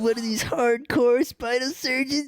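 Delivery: high, put-on teasing voice; mock flirty Kate. Imitating Kate teasing Jack about being hardcore, high and mocking.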